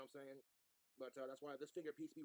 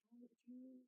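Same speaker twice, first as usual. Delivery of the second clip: high, soft delighted coo at the food; delighted surprise; 11 semitones higher, 5 dB quieter than usual. Real, not acted. Unwrapping the sandwich.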